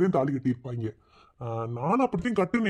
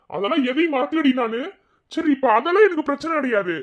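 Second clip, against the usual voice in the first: high-pitched indignant scolding; exasperated, raised-pitch rebuke; 9 semitones higher, 4 dB louder than usual. Acted. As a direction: tell her off, pitch jumping high. Husband scolds wife for disrespect, crying.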